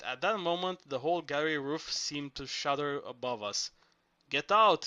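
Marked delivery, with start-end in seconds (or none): 4.3-4.9 loud, high hoarse yell; hoarse shout. A narrator's reading. Zorba warning the workmen of collapse, hoarse shout. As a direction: bark the warning as the roof shudders. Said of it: "Zorba shouted in a hoarse voice".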